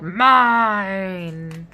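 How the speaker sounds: long, loud drawn-out wail; a dino wailing over the food; wailing mine. Acted. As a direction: wail it during a tug of war. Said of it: "as he wailed".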